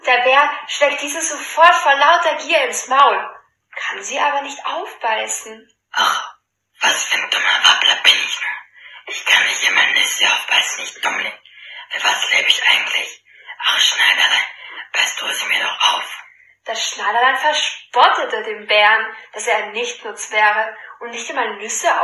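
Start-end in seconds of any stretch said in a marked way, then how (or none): 5.9-16.2 low, growling greedy bear; deep bear growl. Acted. Bear laments. (tiefe Brummstimme eines Bären)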